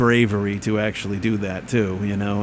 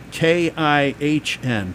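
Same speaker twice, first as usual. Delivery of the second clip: letter by letter, very slowly; spelling it out; 4 semitones higher, much slower than usual. Shown as a demonstration. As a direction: spell K I H N with each letter drawn out. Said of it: "just like how I spell".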